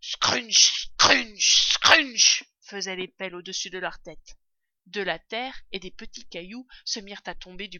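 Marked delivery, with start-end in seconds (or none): 0.0-2.4 loud, harsh imitated scraping; shovel crunch imitation. A narrator's reading. Shovels dig above the foxes, loud imitated crunching. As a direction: punch each crunch like a digging blade.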